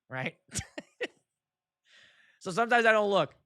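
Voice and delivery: usual speaking voice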